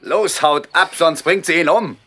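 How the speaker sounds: high, loud warning; urgent shout. Acted. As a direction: urge them off in a hurry. Urging soldiers to flee.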